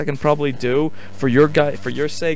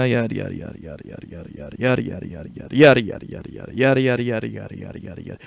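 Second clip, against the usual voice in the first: rattled-off filler, rising and sinking; skimming babble; 3 semitones lower, faster than usual. Pretend. Player skipping long game dialogue, rapid dismissive babble.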